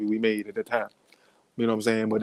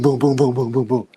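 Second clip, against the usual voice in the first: ordinary talk, nothing marked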